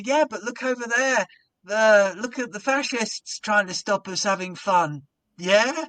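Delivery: high, loud stirring call; rabble-rousing call. Retold or imitated. Compere turning the crowd on the police, loud call. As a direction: whip them up against the police. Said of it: "And I go".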